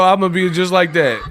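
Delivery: ordinary talk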